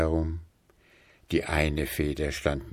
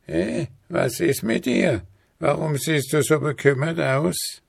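hohe, fürsorgliche Altweiberstimme; alte Frau; 9 semitones higher than usual. A narrator's reading. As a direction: neugierig nachfragende alte Frau. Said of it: "Das sprach zu ihm". (hohe Stimme einer alten Frau)

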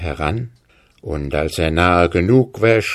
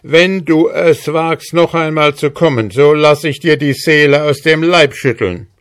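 higher, firm warning voice; threatening soldier; 6 semitones higher than usual. Acted. Hussar warns the dwarf never to return, threatening.

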